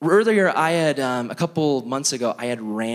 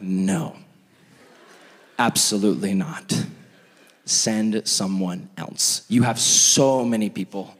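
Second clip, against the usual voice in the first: sulky refusal; 4 semitones lower, slower than usual. Retold or imitated. Refusing God, low sulky.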